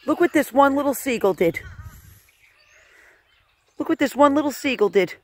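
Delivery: normal talking voice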